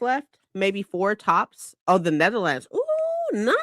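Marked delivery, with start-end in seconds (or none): 2.7-3.5 high, held ooh; impressed ooh. Real, not acted. Streamer reacting to a viewer in the Netherlands, high impressed ooh. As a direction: sound impressed.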